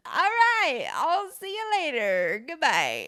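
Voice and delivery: high, squeaky nervous retreat; nervous high squeak. Retold or imitated. Woman retreating from a threatening guy, squeaky nervous goodbye. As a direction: chirp it out like backing away scared.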